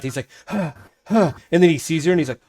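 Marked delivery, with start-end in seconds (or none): 0.5-1.3 two loud, higher panting huffs; out of breath. Acted. Game master acts the kid bursting in, out of breath. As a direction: huff twice as if winded. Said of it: "he's, like, out of breath".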